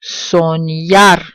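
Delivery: Soñar, leaning on ÑAR; Soñar with a long, firm last syllable; final-syllable stress. Shown as a demonstration. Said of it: "stress will fall on the last syllable".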